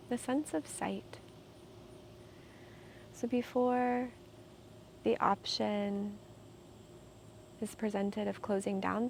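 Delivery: calm, unhurried meditation guide with long pauses; soothing, measured meditation voice; guided meditation. Real, not acted. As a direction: guide them gently into stillness, leaving space between phrases.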